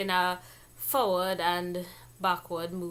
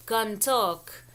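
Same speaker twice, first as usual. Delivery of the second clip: ordinary delivery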